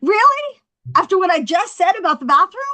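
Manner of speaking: high, loud, incredulous challenge; indignant disbelief. Acted. Comic to man heading for the bathroom, indignant disbelief. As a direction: call him out, pitch jumping up.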